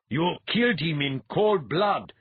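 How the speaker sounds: loud, high, slow denunciation; outraged accusation. A narrator's reading. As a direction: hurl the charge with indignation. Young priest accuses killers.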